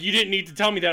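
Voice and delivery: ordinary talk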